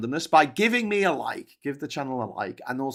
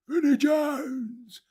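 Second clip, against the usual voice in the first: much higher, slow stretched cry; excited name call; 13 semitones higher, much slower than usual. Acted. Streamer excitedly calling out an actor's name. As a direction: call the name out like a star appears.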